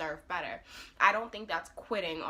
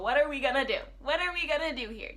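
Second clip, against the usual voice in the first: higher, louder retold taunt; mock challenge; 7 semitones higher, 5 dB louder, faster than usual. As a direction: throw the question at him, imitating. Acts out the captors' taunting challenge.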